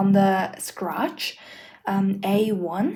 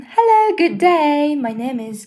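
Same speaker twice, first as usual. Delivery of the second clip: higher, louder hello as a textbook character; cheery textbook greeting; 5 semitones higher, 3 dB louder than usual. Shown as a demonstration. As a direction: read the example introduction in a raised, lively voice. Reads out textbook greeting example.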